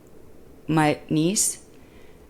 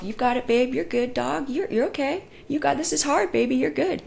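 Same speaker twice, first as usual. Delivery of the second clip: kind self-talk; 7 semitones higher than usual. Retold or imitated. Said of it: "because I can say to myself".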